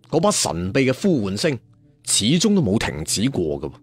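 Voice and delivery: usual speaking voice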